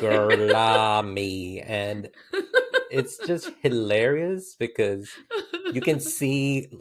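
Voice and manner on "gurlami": very slow, stretched-out mock accent; milk each syllable of the overdone accent; Brad Pitt's accent. Repeating the favourite quote for laughs, hammed-up accent. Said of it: "when Brad Pitt's like".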